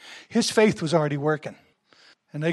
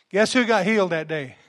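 ordinary delivery

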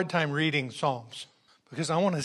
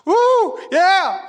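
exultant cry; 13 semitones higher, 8 dB louder than usual. Real, not acted. Exultant cries in praise.

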